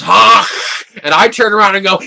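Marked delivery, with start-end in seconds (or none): 0.0-0.5 loud imitated honk; mock goose honk, loud. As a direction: honk like the goose rising off the water.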